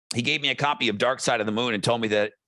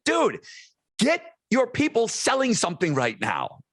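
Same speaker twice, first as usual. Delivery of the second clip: mock exasperation; 10 semitones higher than usual. Retold retort to Franco.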